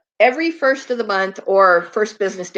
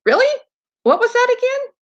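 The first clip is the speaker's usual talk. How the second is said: much higher squealy questions to the dog; excited high pet talk. Real, not acted. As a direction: get the dog worked up.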